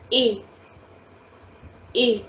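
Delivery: "A" as a clear, closed French é, "ay"; accent aigu sound. Shown as a demonstration. Teacher showing students the sound with accent aigu, closed "ay". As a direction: hold "A" as a pure "ay" vowel. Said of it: "with accent aigu, the sound will be".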